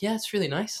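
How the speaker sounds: very high, mock-enthusiastic compliment; retold keen praise. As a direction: imitate the manager in a high, loud voice. Manager's praise acted out.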